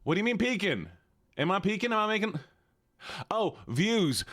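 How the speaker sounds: put-on Southern streamer voice; easygoing Southern accent. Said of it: "go to normal Southern now".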